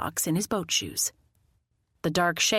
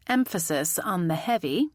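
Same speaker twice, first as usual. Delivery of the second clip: ordinary delivery, nothing marked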